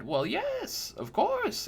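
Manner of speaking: put-on high, sweet old woman voice, fawning; a doting old crone cooing over a young knight; sugary old lady flattering the customer; old crone baker.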